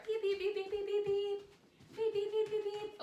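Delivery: high monotone beeps; string of high, even beeps; beeping noise. Shown as a demonstration. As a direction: beep like a reversing truck, high and steady. Imitates beeping while moving backwards.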